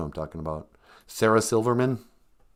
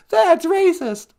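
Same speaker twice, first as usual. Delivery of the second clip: mock outrage; 13 semitones higher, 5 dB louder than usual.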